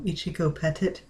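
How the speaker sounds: usual speaking voice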